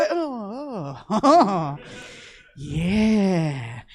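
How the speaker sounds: whimpering sobs retold; mock crying. Crying as the IV goes in, whimpering. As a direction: whimper like the needle just went in. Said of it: "as I'm crying".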